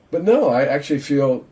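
ordinary talk

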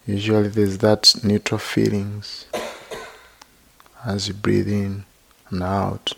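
unhurried, even-toned meditation teacher; guided meditation. Real, not acted. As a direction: gently guide listeners into body and breath, leaving long silences.

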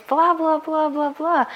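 high, bored blah blah; mock dismissive blah. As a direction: brush it all off mockingly.

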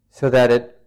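normal talking voice

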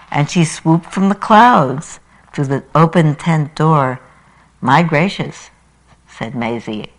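animated rhyming storytelling; story reading. Acted. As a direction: read it like a children's book for the congregation. Preacher reads Seuss story aloud.

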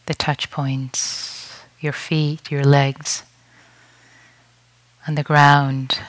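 unhurried meditation guide with long pauses; guided meditation. Real, not acted. Teacher guiding retreatants to settle and breathe, guided meditation voice. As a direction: lead them gently into stillness, leaving space between phrases.

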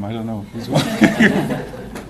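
amused, breaking into real laughter; slow, laughing aside. Real, not acted. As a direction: let the line dissolve into a laugh. Joking about who welcomes whom.

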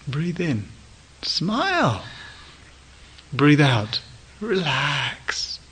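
beam while giving the teacher's words a Thai lilt; Thai accent. Retold or imitated. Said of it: "say it with a kind of Thai accent and a big beaming face".

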